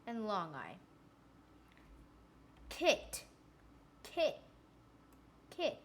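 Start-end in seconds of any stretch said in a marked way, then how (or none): 2.7-5.8 "Kit" said higher three times, short vowel clear; repeated for listening. Shown as a demonstration. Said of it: "Did you hear it?".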